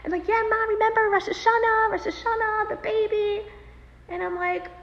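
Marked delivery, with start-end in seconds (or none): 0.3-3.5 put-on high, insistent child's reminder; retold pleading nudge in a raised pitch; frantic kids. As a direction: imitate children urgently prompting mom. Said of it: "my kids are getting all frantic".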